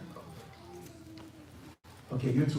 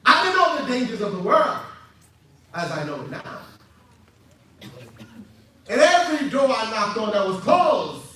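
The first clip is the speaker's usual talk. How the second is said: impassioned preaching at full force; loud, raised testimony to the crowd; 9 semitones higher, 6 dB louder than usual. Real, not acted. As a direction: proclaim it with rising fervour.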